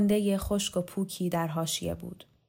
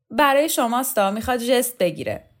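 mocking aside; 5 semitones higher, 7 dB louder than usual. Student mocks to Roxana.

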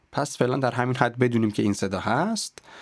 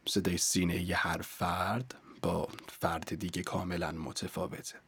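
much softer, hushed chest register; chest voice demo; 3 semitones lower, 10 dB quieter than usual. Shown as a demonstration. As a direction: drop the level right down into a low chest voice.

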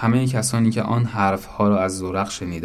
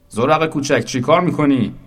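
raised, louder questioning voice; indignant challenge; 6 semitones higher, 5 dB louder than usual. Acted. Bystander to the little one pushing through, indignant challenge.

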